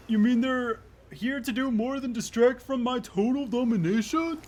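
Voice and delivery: lightly voiced haughty character; domineering game character. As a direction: act the affronted killer.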